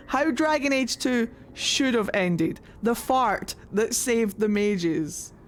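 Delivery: usual speaking voice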